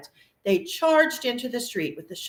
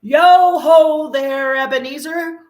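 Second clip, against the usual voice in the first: slow, much higher and louder hearty call; fat jovial voice; 8 semitones higher, 10 dB louder, slower than usual. A narrator's reading. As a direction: call out like a jolly fat old master. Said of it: "comfortable, oily, rich, fat, jovial voice".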